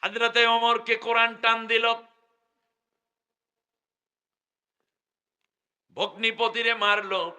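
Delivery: high, loud, slowing sermon cry; fiery preaching. Acted. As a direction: thunder out the story's climax. Preaching at full force. (উচ্চস্বরে উত্তেজিত ওয়াজ)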